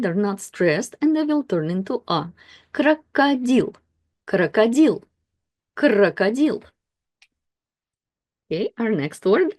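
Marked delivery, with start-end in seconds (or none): 2.7-6.6 crocodile said as krakadil, stress on the last syllable, both O's as A; careful Russian reading. Shown as a demonstration. Teacher reading крокодил aloud for learners, careful. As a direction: sound out crocodile with reduced O's. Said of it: "both of these O's will be affected".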